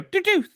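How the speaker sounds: squeaky high doo-doo; high excited doo-doo. Shown as a demonstration. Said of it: "If I'm excited, I go".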